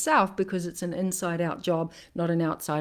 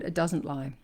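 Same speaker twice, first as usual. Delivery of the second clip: usual speaking voice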